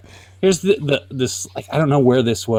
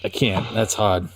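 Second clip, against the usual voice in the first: ordinary delivery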